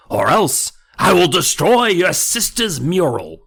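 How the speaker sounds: loud, slow, dragged-out dummy voice; creepy dummy threat. Acted. The dummy tells Amy she's his slave forever, slow and loud. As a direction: savour the threat slowly.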